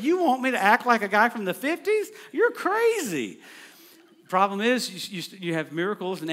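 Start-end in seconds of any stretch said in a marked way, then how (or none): 0.0-3.4 much higher, louder, scoffing; scoffing at the marketing people; incredulous retort. Retold or imitated.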